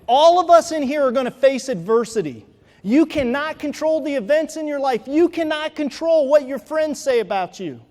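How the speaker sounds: loud, fast rallying exhortation; preaching at full force. Acted. Speaker rousing the audience about adversity, loud and fast. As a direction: drive it home to the crowd, building.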